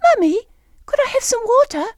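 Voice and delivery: put-on high, loud little-girl Gloucestershire voice; Gloucestershire child accent. Daughter asking mummy for water, high chirpy Gloucestershire accent. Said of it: "chirped in a perfect Gloucestershire accent".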